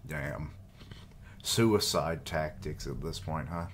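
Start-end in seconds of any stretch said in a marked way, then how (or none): none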